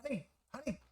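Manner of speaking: high, muffled voice behind a door; muffled, high-pitched fussing heard from outside. Acted. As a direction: talk as if heard through a door. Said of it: "like, muffled voice".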